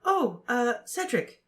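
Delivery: put-on high, hesitant girl's voice; pause, then lift the name high; hesitant Cho. A narrator's reading. Cho telling Harry her date, high and hesitant. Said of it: "she said".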